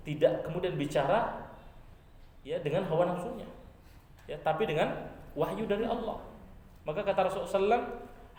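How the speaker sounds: usual speaking voice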